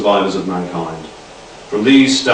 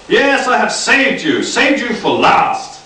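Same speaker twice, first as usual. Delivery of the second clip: higher, slow grandiose proclamation; hammed-up dictator; 10 semitones higher than usual. A narrator's reading. Nick to the refugees, hammy grandiose cry. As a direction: proclaim it like a mock tyrant. Nick's villain voice continues.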